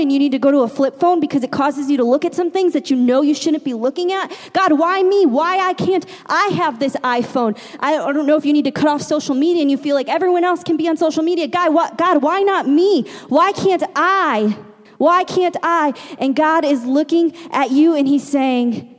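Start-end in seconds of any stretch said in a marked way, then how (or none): 4.5-7.6 high-pitched mock complaint to God; pleading, pitch up high; whining protest. 11.4-15.9 drawn-out, high-pitched "why me" wail; acted childlike complaint; pleading protest.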